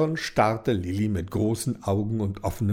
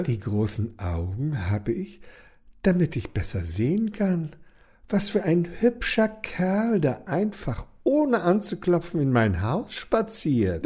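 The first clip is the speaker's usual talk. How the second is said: flirty grandma; 5 semitones higher than usual.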